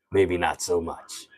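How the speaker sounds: low, soft, slow impersonation voice; Rob Sterling. Acted. Streamer doubts the impression while still in it, low and slow. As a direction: draw it out low and quiet in character. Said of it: "He sounds a lot like Rob Sterling".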